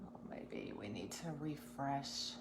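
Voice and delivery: soft, unvoiced whisper. Real, not acted. Host whispering while fixing the stream, soft whisper. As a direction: murmur it without voice. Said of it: "I don't know why I'm whispering".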